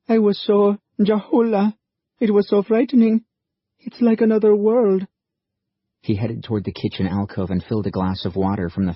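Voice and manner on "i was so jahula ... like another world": put-on high, shaken young woman's voice; scared and overwhelmed, pleading to be understood; frightened girl. A narrator's reading. Sal telling Liam how frightening the new world is, high and shaken.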